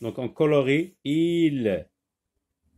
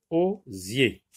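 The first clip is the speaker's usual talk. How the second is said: "Osier" as two separate beats, O, zier; split into syllables. Shown as a demonstration. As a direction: pause inside "Osier" after the O. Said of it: "donc deux syllabes".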